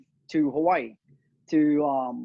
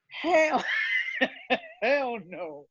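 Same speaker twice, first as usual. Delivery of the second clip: laughing refusal; 11 semitones higher, much slower than usual. Retold or imitated.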